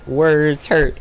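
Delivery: loud mock-dramatic declaration; mock-dramatic shout. Streamer loudly jokes that words hurt him, mock-dramatic shout. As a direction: boom it out like a hurt hero. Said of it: "repeated loud declaration".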